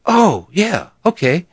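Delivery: high, surprised squeak of agreement; stunned high yeah. Retold or imitated. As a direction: caught off guard, say yes too fast. Stunned reply to DJ offer.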